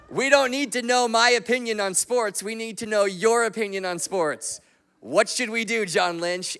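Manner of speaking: high, loud mock pleading; incredulous protest. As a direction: throw the question back at the expert, hammed-up. Acts out his incredulous inner protest.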